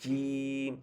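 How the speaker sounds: জিম held long; drawn-out letter name. Shown as a demonstration. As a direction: sing out জিম slowly. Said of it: "যেমন দেখেন".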